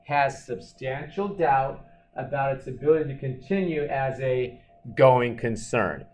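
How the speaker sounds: ordinary delivery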